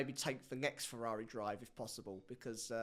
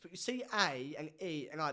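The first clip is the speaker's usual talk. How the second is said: normal talking voice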